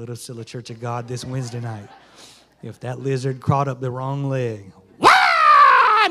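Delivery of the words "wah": high, loud mock shriek; imitated scream. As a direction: yelp like a startled woman seeing a lizard. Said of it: "Nadine would be screaming".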